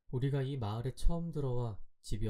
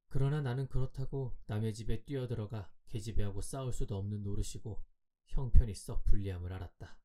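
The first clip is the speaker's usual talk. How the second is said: ordinary delivery, nothing marked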